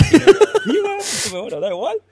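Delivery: high, loud laughing through the words; giggling, shaken relief after the gorilla. Retold or imitated. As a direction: laugh off the fright. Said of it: "Y yo diciendo".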